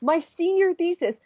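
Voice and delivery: high, strained, almost wailing; exasperated rant. Real, not acted. Telling friends about her Ava credentials, high and exasperated. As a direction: vent how absurd it was.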